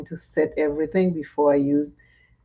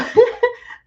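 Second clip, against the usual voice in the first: high squealing laugh; high burst of laughter; 5 dB louder than usual. Real, not acted. Laughing with viewers about the trip.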